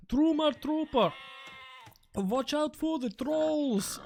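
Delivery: high, slow, badly faked German accent; sing-song mock German that drifts Nordic; hammed-up high-pitched German accent; attempted German accent. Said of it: "I was trying to do German".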